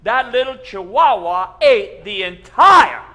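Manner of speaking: loud, high, building preacher excitement; excited tall tale. Acted. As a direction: tell it big and breathless. Pastor finishes the tall tale.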